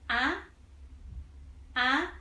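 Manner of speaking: "ah" rising straight up from mid pitch; rising second tone. Shown as a demonstration. Teacher shows second tone to learners, pitch going straight up. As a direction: lift "ah" like you have a question. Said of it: "go up directly".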